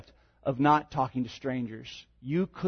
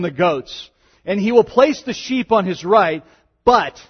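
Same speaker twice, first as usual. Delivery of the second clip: raised, solemn reading voice; scripture reading; 6 semitones higher, 9 dB louder than usual. Acted. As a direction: proclaim the passage to the congregation. Reads Matthew 25 aloud.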